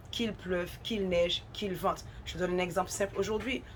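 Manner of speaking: usual speaking voice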